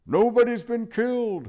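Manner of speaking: high, loud hoot; hooted loudly. Acted. Owl loudly correcting the deaf dwarf. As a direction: hoot it at full volume. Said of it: "hooted the owl".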